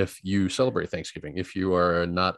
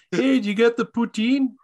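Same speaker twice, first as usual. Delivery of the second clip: much higher hammed-up Canadian voice; mock Canadian; 13 semitones higher, 4 dB louder than usual. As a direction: call out like a cartoon Canadian. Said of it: "Talk Like a Canadian Day".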